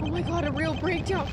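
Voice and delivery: ordinary talk, nothing marked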